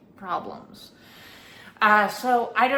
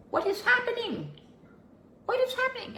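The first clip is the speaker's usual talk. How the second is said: much higher, squeaky disbelief; incredulous squeak; faster than usual. Retold or imitated. Narrator reliving her confusion at the shift, squeaking. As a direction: let the voice shoot up in bewilderment. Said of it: "And I'm like".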